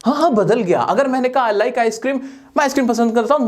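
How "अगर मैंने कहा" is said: normal talking voice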